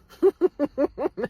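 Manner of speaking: high, loud laugh; burst of laughter. Real, not acted. Laughs amid retelling.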